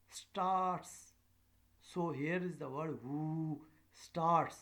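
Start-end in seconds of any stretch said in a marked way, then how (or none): 0.1-1.1 STARTS held high and loud; rising intonation. Shown as a demonstration. Teacher isolating the rising word of the question, raised pitch. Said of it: "So this is the rising". 3.0-4.6 "who" and "starts" drawn out high; rising intonation. Shown as a demonstration. Teacher pointing out the question's rising words, raised pitch. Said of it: "So this is the rising".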